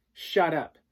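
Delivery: high, loud shut-up; angry retort. Acted. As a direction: snap back at the bully. Boy snaps at bully.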